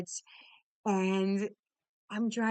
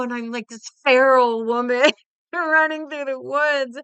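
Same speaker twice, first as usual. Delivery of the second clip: loud, higher, laughing excitement; excited; 5 semitones higher, 12 dB louder than usual. Real, not acted. Runner describing herself as a feral woman, loud and gleeful. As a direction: tell it with wild glee.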